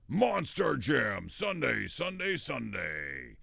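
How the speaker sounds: loud, stretched, hyped ad shout; monster truck ad announcer. Shown as a demonstration. Voice actor parodying a monster truck radio ad. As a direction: scream it like a truck rally ad.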